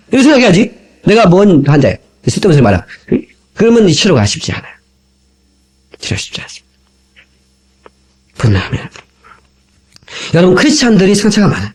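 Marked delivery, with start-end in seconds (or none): 6.0-8.9 a hushed whisper that drives the point home. Shown as a demonstration. Preacher whispering the warning to his congregation. Repeats the line, then whispers it.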